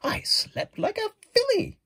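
very high horse voice; prancing horse, high and bright. Acted. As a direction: squeal it out like a pony. Horse speaks.

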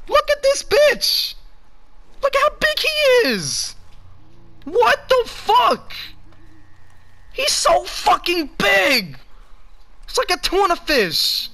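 high-pitched gamer freak-out; excited shrieking. Real, not acted. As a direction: scream with joy at a giant catch. Shrieks over giant fish.

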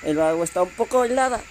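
usual speaking voice

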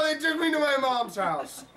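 ordinary delivery

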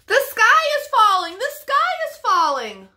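high, loud hen squawk. Acted. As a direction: squawk it like startled chickens. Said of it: "squawked the chickens".

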